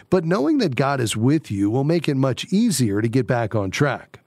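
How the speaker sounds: measured, polished radio host read; radio devotional read. Acted. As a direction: read it like a scripted devotional segment. Scripted devotional read over music.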